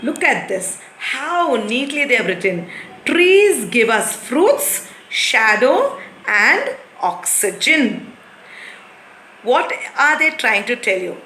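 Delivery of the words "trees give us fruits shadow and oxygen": slow, careful read-aloud; slow model reading. Shown as a demonstration. Teacher reads the book's example sentence slowly. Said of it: "reading the book's model".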